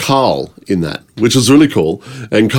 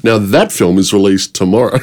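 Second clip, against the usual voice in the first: ordinary talk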